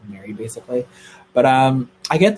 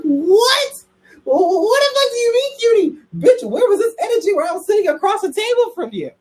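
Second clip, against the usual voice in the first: squeaky much higher voice, half laughing in disbelief; shocked disbelief; 4 dB louder than usual. Retold or imitated. Reacting to Brady calling her cutie, high shrieking disbelief. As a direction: screech it like you can't believe the text.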